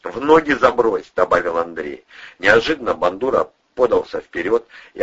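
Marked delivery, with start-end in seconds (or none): 0.0-1.1 curt order. A narrator's reading. Ordering captive at gunpoint.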